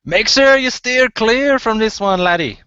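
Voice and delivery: high, loud dwarf voice. Acted. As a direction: call it out as a dwarf walking away. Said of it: "you hear the dwarf say".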